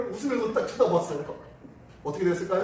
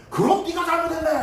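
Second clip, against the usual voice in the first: high, loud verdict of blame; scolding teacher; 10 semitones higher, 7 dB louder than usual. Acted. Teacher blaming the victim after hearing one side, loud scolding. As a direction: pounce on the pupil with an acted reprimand. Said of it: "하면서 막 꾸지람을 한 거예요".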